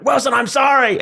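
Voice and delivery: high, loud anguished cry of a name; Tom Hanks Castaway. Retold or imitated. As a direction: wail it like a castaway. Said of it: "Tom Hanks Castaway impression".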